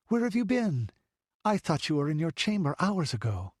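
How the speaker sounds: put-on a noblewoman fretting over the child; anxious young lady. A narrator's reading.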